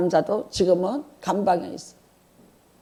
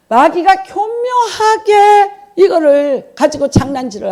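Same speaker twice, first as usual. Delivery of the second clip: higher, louder, slow and drawn out; slow, heavy emphasis on his cunning; emphatic and slow; 8 semitones higher, 5 dB louder, slower than usual. Real, not acted. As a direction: lean hard on every word of the devil's trick. Preacher stresses the devil's cunning.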